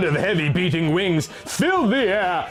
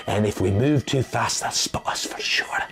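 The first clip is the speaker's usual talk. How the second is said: whispered warning; 5 semitones lower than usual. Acted. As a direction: whisper so the dragons can't hear. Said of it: "Dalamar whispered".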